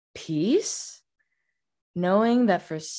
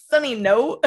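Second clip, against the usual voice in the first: laughing protest; 3 semitones higher, 5 dB louder than usual. Real, not acted. Laughing at friend.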